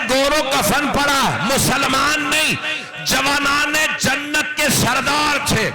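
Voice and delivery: put-on an orphaned girl crying to the faithful, wailing; crying child's plea. A narrator's reading. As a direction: wail it out like a weeping little girl. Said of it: "एक और बच्ची रो रो के कह रही थी".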